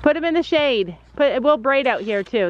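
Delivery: normal talking voice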